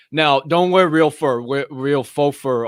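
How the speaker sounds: loud, slow stern command; mock-stern public service warning; emphatic order. Real, not acted. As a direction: lay down the rule loudly. Emphatic PSA.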